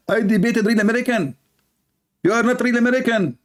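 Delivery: high mocking voice of the imam; mocking imitation. Mocking the Philippine imam's reply, high and sneering. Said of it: "repeats the imam's words".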